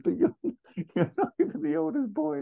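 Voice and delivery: very high-pitched mock protest; mock outraged whine. Retelling a sibling's ridiculous outburst, high mock squeal. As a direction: squeal it in comic imitation.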